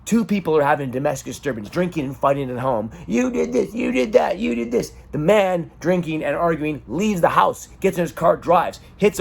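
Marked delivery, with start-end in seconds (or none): 3.1-4.9 high, held-pitch finger-pointing chant; acted-out bickering, pitched way up; mock quarrel accusations. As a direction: hurl the blame back and forth in a raised pitch. Acting out a drunken domestic quarrel.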